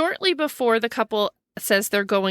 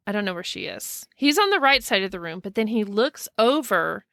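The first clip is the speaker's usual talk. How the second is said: ordinary delivery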